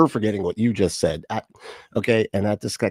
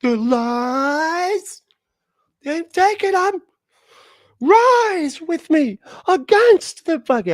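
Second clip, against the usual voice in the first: much higher, hammed-up prophetic mock voice; over-the-top mock divine call to arms; 6 dB louder, much slower than usual. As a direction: parody the preacher's grand proclamation, pitched way up. Mocking the clip's prophet voice.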